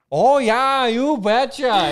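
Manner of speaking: thick Minnesota "you betcha"; drawn-out Minnesota impression; Minnesota accent. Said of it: "that real thick Minnesota accent".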